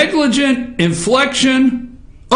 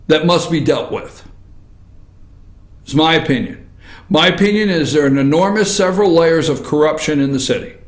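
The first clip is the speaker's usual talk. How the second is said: much lower, earnest and measured; serious, toned down; 8 semitones lower than usual. Real, not acted. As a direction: bring it down and mean every word. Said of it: "let me try to ratchet this down and get serious".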